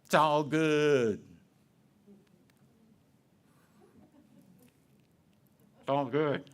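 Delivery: slow, drawn-out glossing it's all good; hollow affirmation. Retold or imitated. Preacher mocking people who cover up frustration. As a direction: paper over the hurt with a breezy phrase.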